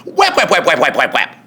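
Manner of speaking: plain reading voice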